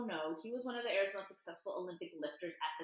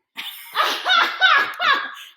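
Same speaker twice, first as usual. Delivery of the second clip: loud pained laughter; 21 dB louder than usual. Real, not acted. Laughing through a leg cramp.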